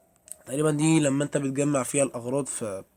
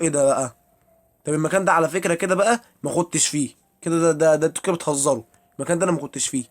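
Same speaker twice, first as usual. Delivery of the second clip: exasperated protest; 2 semitones higher, 7 dB louder than usual. Real, not acted. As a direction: complain you're being trolled. Frustrated with a strange place. (تذمر عالي ومتضايق)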